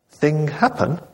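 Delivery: high, simple broken English; lightly voiced servant in broken English.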